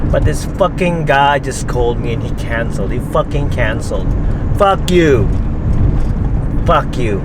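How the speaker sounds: real angry outburst, swearing hard; fed up, punching out the swear words; pissed off. Real, not acted. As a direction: build from heated complaint to loud cursing. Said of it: "Pissed me off".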